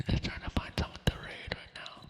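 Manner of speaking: hushed, voiceless aside; ASMR whisper. Real, not acted. Streamer looking for someone to raid, whispering. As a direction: whisper it to the viewers.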